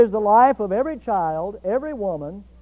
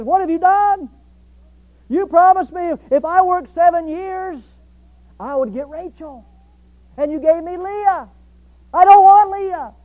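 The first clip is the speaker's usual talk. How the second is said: high, loud acted indignation; outraged protest; 7 semitones higher, 7 dB louder than usual. Deceived Jacob confronts his father-in-law, loudly indignant. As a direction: confront the cheat, building to a loud demand.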